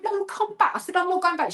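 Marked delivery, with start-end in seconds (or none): 0.0-0.7 high, urgent call; calling back. Retold or imitated. The author calling the fleeing friend back, high. 1.0-1.5 high, insistent mock refusal; frightened refusal. To the author, refusing to return, high and scared.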